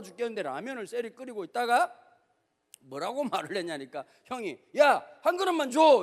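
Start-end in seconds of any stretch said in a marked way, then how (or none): 4.8-6.0 loud, high begging call for food; hungry older brother begging. Retold or imitated. Starving Esau begs his brother for ramen, loud and high. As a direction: beg loudly for one bowl, starving. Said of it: "이렇게 얘기했단 말이야".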